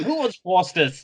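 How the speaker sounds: hammed-up Australian offer; Australian accent. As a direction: call it out in a broad Australian accent. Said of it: "such an Australian accent".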